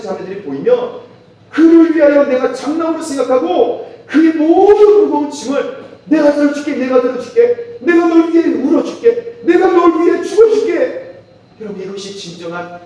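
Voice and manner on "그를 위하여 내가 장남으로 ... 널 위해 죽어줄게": impassioned pledge. Acted. Preacher voicing the believer's vow to weaker siblings, fervent. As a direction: swell into a pleading vow.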